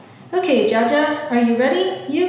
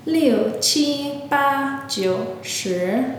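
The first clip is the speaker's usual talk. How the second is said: slow; much slower than usual. Shown as a demonstration. Host repeats counting for learners.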